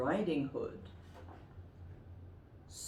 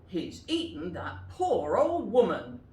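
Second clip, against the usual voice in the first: shocked; 2 semitones higher, 5 dB louder than usual. Acted. Woodcutter's shocked realisation.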